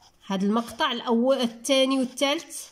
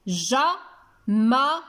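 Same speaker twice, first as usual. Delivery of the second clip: جمل drawn out as ja, ma, lun; split into syllables; much slower than usual. Shown as a demonstration. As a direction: pause between each syllable of جمل. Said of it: "بطريقة التصفيق".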